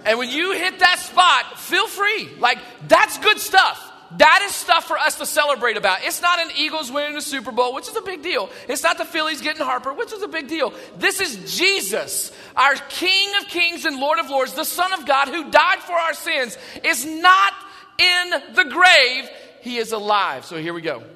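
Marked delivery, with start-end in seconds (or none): 0.0-6.0 louder, higher, urging the crowd on; excited. Real, not acted. Pastor urging the congregation to cheer, excited. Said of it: "get a little excited". 16.8-20.4 loud proclaiming shout, grave drawn out slow. Real, not acted. Pastor proclaiming the resurrection, shouting. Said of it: "get a little excited".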